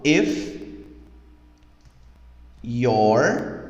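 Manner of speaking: each word set apart with long pauses; slow spaced-out model of the lyric. Shown as a demonstration. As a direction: space the words out one at a time for learners. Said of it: "quando eu falo".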